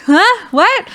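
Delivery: sudden high, loud confused huh; groggy shock at the news; startled huh. Retold or imitated. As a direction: jolt awake and blurt it out. Jolted awake by labor news.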